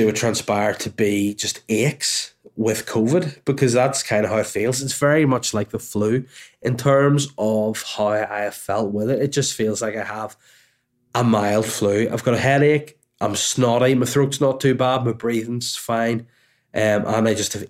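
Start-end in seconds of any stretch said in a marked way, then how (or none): none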